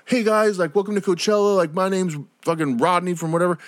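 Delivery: high, bubbly host voice mocking a festival reporter; mock interviewer.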